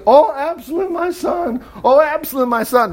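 high-pitched, acted wail of grief; weeping lament. A narrator's reading. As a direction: mourn aloud, pitched high, without real sobs. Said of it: "kept on weeping".

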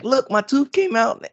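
high-pitched imitation of a thrilled little boy; excited child. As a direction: mimic a son proudly announcing big news, pitch way up. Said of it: "he was excited".

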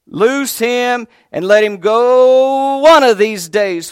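high, slow, loud proclamation; fervent preaching shout. Acted. Preacher promises the congregation resurrection, fervent shout. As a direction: preach it at full force. Preacher repeats climactic line.

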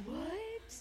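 high squeaky what; high-pitched stunned what; shocked. Retold or imitated. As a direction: squeal it in disbelief. Shocked reaction.

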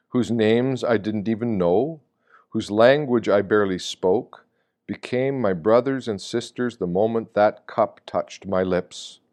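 usual speaking voice

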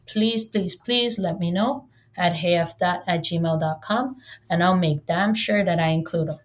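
ordinary talk, nothing marked

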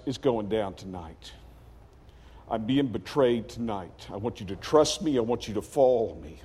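low-pitched, reassuring huddle talk; calm, confiding leader. Retold or imitated. Jesus to his disciples before betrayal, low reassurance. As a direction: confide in close friends, low and steady.

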